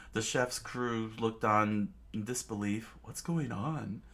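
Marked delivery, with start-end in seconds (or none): none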